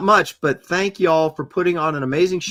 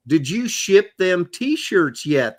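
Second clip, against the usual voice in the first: slow put-on Southern drawl; Southern accent; 2 semitones higher, much slower than usual. Host redoing the viewer's question, Southern accent. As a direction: draw the question out, Southern style. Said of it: "that was my Southern accent".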